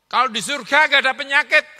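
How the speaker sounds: loud, high preaching cry; preaching shout. Real, not acted. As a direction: call it out to the whole church. Preacher exhorting congregation.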